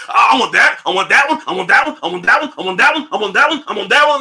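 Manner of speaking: mock greedy whine. Broke sneakerhead pointing at shoes, mock whiny demands. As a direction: chant the want like a greedy kid.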